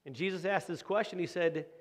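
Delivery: ordinary talk, nothing marked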